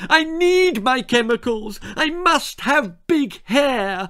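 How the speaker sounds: high, loud, slow insistent whine; demanding spoilt girl. A narrator's reading. Esmeralda demanding big hair from Spike. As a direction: stamp your foot and demand it.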